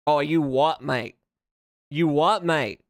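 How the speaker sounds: put-on British challenge, possibly Australian; mock British accent. Streamer testing whether it sounds British or Australian, in mock British accent. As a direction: challenge someone in a rough attempt at British. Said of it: "British or Australian?".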